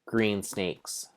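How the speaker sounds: ordinary delivery, nothing marked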